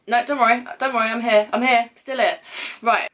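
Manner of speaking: ordinary talk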